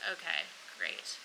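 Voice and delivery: low, quiet, slow resignation; underwhelmed. Retold or imitated. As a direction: let it drop, deadpan and slow. Unenthused reply to surgery news.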